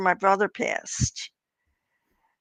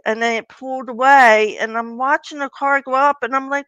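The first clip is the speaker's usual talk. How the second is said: ordinary talk, nothing marked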